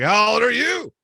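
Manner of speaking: buzzed friend. Retold or imitated. Drunk friend asking the woman her age, loud and high. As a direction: blurt it out like a buzzed buddy. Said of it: "He's like".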